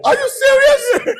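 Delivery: squeaky-high, drawn-out question; high-voiced disbelief. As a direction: shoot the voice way up and stretch it. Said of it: "when your voice gets high".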